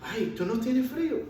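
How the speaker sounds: "¿tú no tienes frío?" high and quick, climbing toward "frío"; high, fast "Ay, ¿tú no tienes frío?" expecting a yes; Spanish negative question. Acted. Teacher shows the same question in Spanish, high quick questioning tune. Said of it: "If you say in Spanish".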